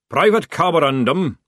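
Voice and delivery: loud, barking sergeant; sergeant's bark. A narrator's reading. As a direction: bark the order loudly. Sergeant calling out.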